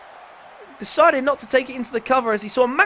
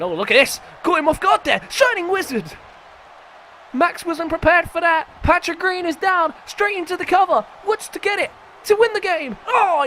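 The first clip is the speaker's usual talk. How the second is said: excited; 7 semitones higher than usual. Real, not acted. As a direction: call the near-fall with real excitement. Sudden near-finish in the match.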